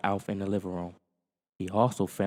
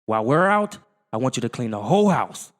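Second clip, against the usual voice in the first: raised, firm instruction; stern order; 4 semitones higher, 8 dB louder than usual. Acted. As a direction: give orders, firm and loud. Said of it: "said Willie".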